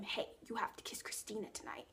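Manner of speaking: put-on hushed, secretive whisper to a boyfriend; whispered urging. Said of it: "like, whispering and stuff".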